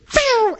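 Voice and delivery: very high zooming "phew"; car speeding off. Retold or imitated. Preacher retells woman abandoning hitchhiker, high zoom noise. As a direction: imitate a car tearing away. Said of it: "And she goes".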